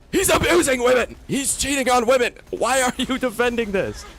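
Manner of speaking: ranting at fans who defend a cheater, shouting; outraged shout. Real, not acted. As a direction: yell it in disbelief.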